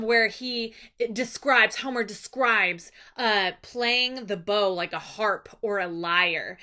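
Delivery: usual speaking voice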